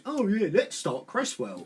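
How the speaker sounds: usual speaking voice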